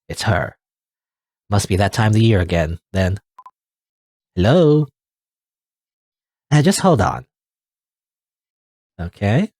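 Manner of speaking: ordinary delivery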